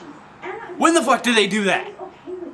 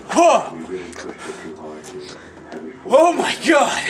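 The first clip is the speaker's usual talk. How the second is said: startled gasp and cry; 2 semitones lower, slower than usual. Retold or imitated. Seeing a face at the door, startled shout. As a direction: jump out of your skin.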